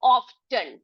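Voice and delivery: often with the t sounded, "off-ten"; spelling pronunciation. Shown as a demonstration. Teacher reading often by its spelling, spelling pronunciation. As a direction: hit a clear t in often. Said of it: "spelling के हिसाब से हम पढ़ेंगे".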